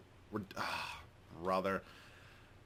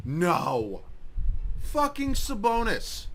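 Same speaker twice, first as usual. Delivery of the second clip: slow, high, drawn-out angry swear; frustrated curse; 8 semitones higher, 4 dB louder, much slower than usual. Real, not acted. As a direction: drag the name out in disbelief and anger. Angry at opponent's play.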